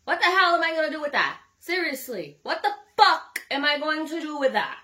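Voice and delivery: high, loud, fast comic outrage; loud disbelieving rant at the guys; indignant outburst. Real, not acted. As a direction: shout it up high in mock outrage. Indignant rant about small size.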